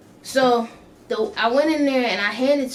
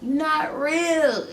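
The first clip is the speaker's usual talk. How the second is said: very slow, higher you not real; spaced and a bit higher, dazed; 5 semitones higher, much slower than usual. Retold or imitated. Repeating to stepmom.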